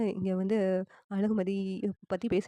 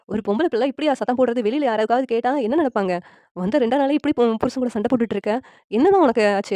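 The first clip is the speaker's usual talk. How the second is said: loud, higher-pitched reprimand; angry scolding; 4 semitones higher, 8 dB louder than usual. A narrator's reading. Mother-in-law berates the bride for fighting with her husband, scolding. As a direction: scold with rising outrage, ending on a loud burst.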